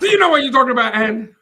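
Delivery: loud, high teasing challenge; mock shouting. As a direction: fire it back in a playful shout. Shouts back in mock argument.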